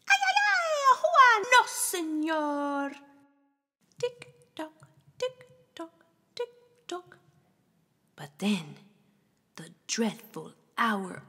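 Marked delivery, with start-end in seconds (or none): 0.1-2.9 very high, loud yelp; panicked cry. Acted. The beaten Chamuco crying out in panic, high. 4.0-7.2 high, soft tick tock; clock ticking. Acted. Reader counting down the clock, high and soft.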